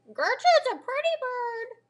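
very high, slow parrot squawk. A narrator's reading. Parrot praising herself in the pet store, squawky parrot voice.

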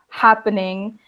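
ordinary talk, nothing marked

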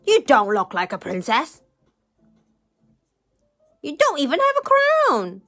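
snapping. A narrator's reading. Rude man doubting the princess, snapping. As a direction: snap scornfully at the claimant. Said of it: "Mister Uppity snapped".

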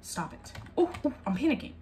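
ordinary delivery

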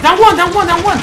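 high, loud excited callout; grenade warning yell. Real, not acted. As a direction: shout the kill to your teammate. Kill after grenade throw.

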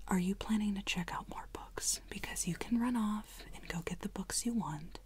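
usual speaking voice

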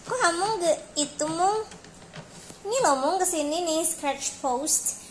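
high, loud coaxing voice for a cat; 1 high pet-talk voice. Real, not acted. Owner coaxing her cat Hamung toward the post, high pet voice.

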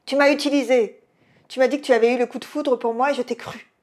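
put-on high, loud drunken accusation. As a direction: shout the betrayal so the whole bar hears. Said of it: "Elle avait haussé le ton". (accusation criée, furieuse et éméchée)

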